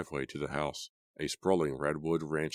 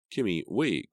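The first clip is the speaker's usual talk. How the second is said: raised voice after her; calling out; 6 semitones higher, 5 dB louder, slower than usual. A narrator's reading. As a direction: call her back. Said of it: "Corky called".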